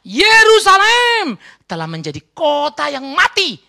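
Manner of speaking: high, loud, drawn-out proclamation; full-force preaching. Real, not acted. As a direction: proclaim it slowly over the congregation. (seruan khotbah lantang dan lambat)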